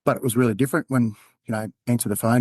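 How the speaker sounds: normal talking voice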